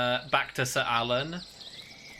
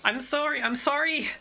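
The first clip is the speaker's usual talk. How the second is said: contained shout, pitched way up; 13 semitones higher than usual. Acted. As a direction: call it out in a high, panicked voice after a gasp. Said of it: "she just shouts out".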